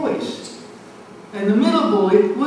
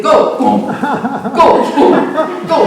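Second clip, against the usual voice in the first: louder, laughing as he calls the punches; laughing through it; 4 semitones higher, 7 dB louder, much slower than usual. Retold or imitated. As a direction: call out the game through a laugh. Retelling the boxing game with glee.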